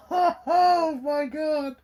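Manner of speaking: laughing disbelief. Real, not acted. Reviewer laughing at the absurd plot, laughing disbelief.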